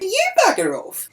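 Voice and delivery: high-pitched retort thrown straight back; re-enacted heated comeback; indignant comeback. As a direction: fire the insult back, voice shooting up. Teller snaps back in quarrel.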